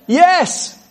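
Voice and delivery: high, thrilled cry of joy; excited yes. Real, not acted. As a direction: cheer it out, delighted by the promise. Preacher exults over scripture.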